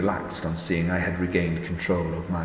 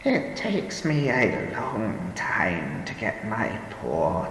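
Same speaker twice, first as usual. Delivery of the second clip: put-on slow, tired old woman voice; weary old woman; 3 semitones higher, slower than usual. A narrator's reading. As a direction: sound worn out and frail.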